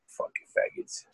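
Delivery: whispered under the breath; contemptuous whisper aside; muttered insult. Retold or imitated. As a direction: hiss the insult so nobody hears. Muttered insult at rival coaches.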